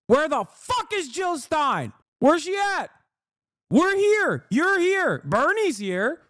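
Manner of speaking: usual speaking voice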